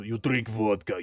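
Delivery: slow, deepened Russian voice; heavy Russian drawl; Russian accent. Shown as a demonstration. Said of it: "You really deepen your voice and you sound Russian".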